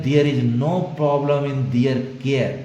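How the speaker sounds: slow read-aloud; slow model sentence. Shown as a demonstration. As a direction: read very slowly, word by word. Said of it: "ये verb की तरह use किए".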